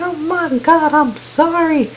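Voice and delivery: high, panicky mock apology; mock freaking out. Woman apologizing after shooting Omid, high-pitched mock panic. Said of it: "freaks out".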